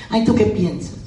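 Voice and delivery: hammed-up pleading salesperson with a laugh; mildly mock a needy, wheedling pitch; a light parody of someone begging for a meeting. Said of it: "Como si estuvieras pidiéndome, eh, limosna".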